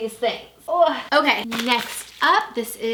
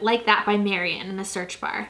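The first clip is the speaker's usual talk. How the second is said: ordinary talk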